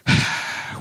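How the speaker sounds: long breathy sigh; disappointed exhale before carrying on; resigned sigh. Acted. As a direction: let out a soft, heavy sigh. Disappointed at no callback.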